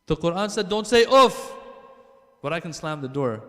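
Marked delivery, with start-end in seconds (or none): 0.1-1.5 higher, louder burst of disbelief; exasperated outburst. Real, not acted. Preacher mocking a son's excuse to the congregation, loud and incredulous. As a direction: throw the line out in disbelief.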